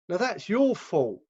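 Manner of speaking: usual speaking voice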